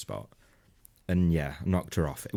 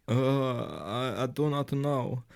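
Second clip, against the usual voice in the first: put-on mild Polish accent; 6 semitones higher, much slower than usual. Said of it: "she was Polish".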